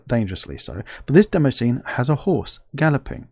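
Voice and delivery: ordinary talk, nothing marked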